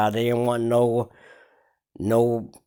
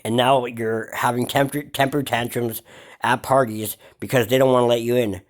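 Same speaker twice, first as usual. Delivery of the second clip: ordinary talk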